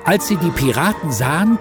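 ordinary delivery

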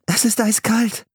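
put-on a girl who is freezing; girl voice, cold. A narrator's reading. Anne tells her brother the water is icy, high.

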